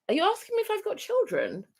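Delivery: high-pitched disbelieving question; mock incredulous. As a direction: act taken aback by a nosy question. Acted retort to natural hair police.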